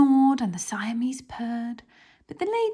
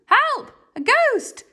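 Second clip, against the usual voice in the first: much higher, much louder scream; terrified yell; 7 dB louder than usual. Acted. The thieves yelling as they flee the ghost. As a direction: yell in panic. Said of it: "yelled Len and Hugh".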